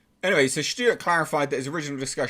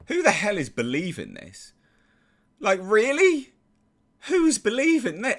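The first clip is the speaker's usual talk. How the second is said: incredulous; 9 semitones higher, 4 dB louder than usual. Real, not acted. Incredulous at the claim.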